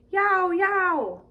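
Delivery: tomcat yowl. Acted. Reader voicing the tiger tomcat, playful high yowl. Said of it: "and a tiger tomcat".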